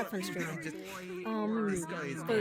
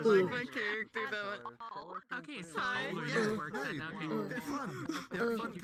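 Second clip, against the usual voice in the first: queasy groans; 3 semitones lower, 9 dB quieter than usual. Real, not acted. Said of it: "every time I turn my head".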